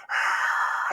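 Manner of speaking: breathy crowd roar; imitated happy cheer. Small group hearing of his conversion, imitated cheer. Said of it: "they were all happy".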